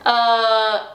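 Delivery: usual speaking voice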